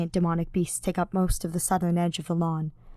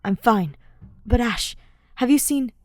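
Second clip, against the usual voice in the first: louder, urgent; anxious rush; 4 semitones higher, 6 dB louder than usual. A narrator's reading. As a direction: push the words out breathlessly. Felix anxious, breathless.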